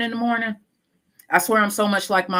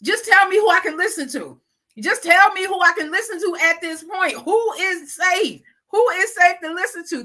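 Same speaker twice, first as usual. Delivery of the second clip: pleading man; 10 semitones higher, 4 dB louder than usual. Retold or imitated. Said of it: "the man was saying".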